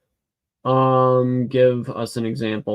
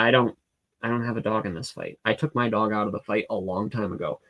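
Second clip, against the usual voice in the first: ordinary delivery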